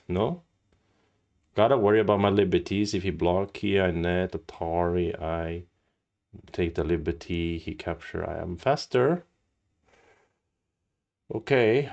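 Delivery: ordinary delivery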